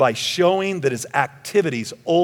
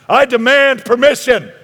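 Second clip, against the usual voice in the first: high, loud, pompous insistence; hammed-up indignant shout; mock demand; 10 semitones higher, 10 dB louder than usual. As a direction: demand it with absurd over-the-top force. Mocking a contradictory demand.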